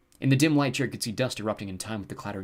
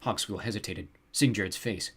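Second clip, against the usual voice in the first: ordinary delivery, nothing marked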